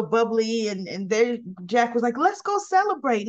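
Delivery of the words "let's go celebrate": higher, mildly excited quote; eager retelling. Retold or imitated. Jack proposes lunch to celebrate, eager. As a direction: lightly act out an upbeat invitation.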